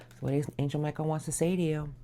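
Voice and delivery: hushed, fast whisper to the angels. Real, not acted. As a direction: breathe the question quickly, no voice. Asks the angels a question.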